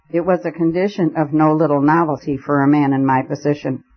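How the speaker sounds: ordinary delivery, nothing marked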